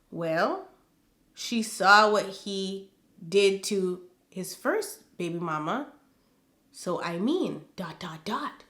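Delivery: pointed understatement. Retold or imitated. Quoting her comment on the baby mama, pointed understatement. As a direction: let it trail quietly, implying the rest.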